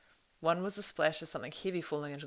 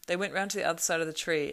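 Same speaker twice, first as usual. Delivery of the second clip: ordinary delivery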